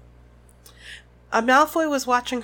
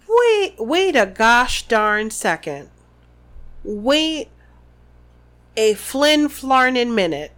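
mock outrage; 3 dB louder, slower than usual. Reader interrupts in mock outrage.

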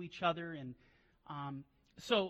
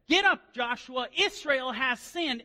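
loud, slow, higher booming command; commanding voice of God; 7 semitones higher, 13 dB louder, slower than usual. Acted. The Lord rousing Joshua over Israel's sin, booming and slow. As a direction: call Joshua to his feet.

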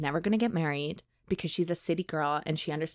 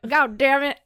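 higher, louder mock exasperation; mock frustrated outburst; 7 semitones higher, 7 dB louder than usual. Daughter groans at being sent to the ball, mock exasperated. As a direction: blurt it out like a grumpy daughter. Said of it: "And she's like".

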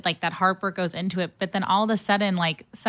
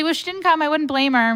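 high joking self-put-down; self-mocking quip; 9 semitones higher, 4 dB louder than usual. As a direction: toss it off high and wry. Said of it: "I was like".

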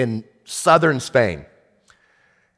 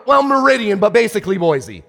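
excited answer; 7 semitones higher than usual. Retold or imitated. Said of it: "I go".

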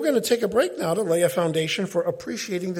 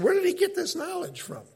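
much higher astonished question; amazed crowd; 12 semitones higher than usual. Retold or imitated. Amazed listeners asking about Jesus, much higher. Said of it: "That's why they said".

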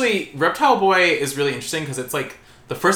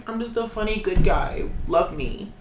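smug nice guy; 6 semitones higher than usual. Retold or imitated. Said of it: "he's like".

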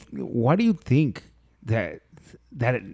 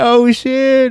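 mock surprise; 11 semitones higher, 7 dB louder than usual. Mock reaction on party line.

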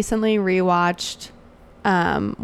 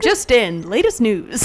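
punchy, slightly louder bulletin opener; mock news announcer; 3 semitones higher, 5 dB louder, slower than usual. Co-host opening a breaking-news bit, mock anchor delivery.

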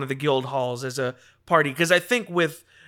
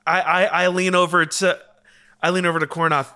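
usual speaking voice

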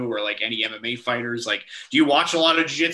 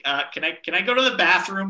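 put-on small child asking, voice higher; little kid voice; 7 semitones higher than usual. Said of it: "I'm four".

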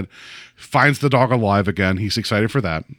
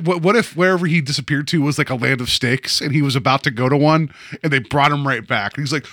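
normal talking voice

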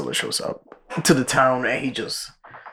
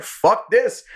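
mock panic; 12 semitones higher than usual. Pilot bails out of plane.

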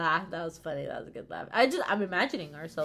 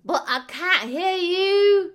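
put-on high, loud, slow old-lady shout; hammed-up hard-of-hearing granny; deaf old lady; 9 semitones higher, 9 dB louder, much slower than usual. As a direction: yell like a grandma who can't hear. Imitating deaf elderly selves.

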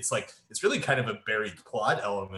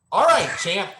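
loud, high mock director's call; slow, hammed-up instruction to a child actor; David Lynch; 10 semitones higher, 7 dB louder, much slower than usual. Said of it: "imagine David Lynch on the set directing".

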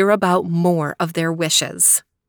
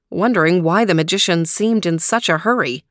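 ordinary delivery, nothing marked

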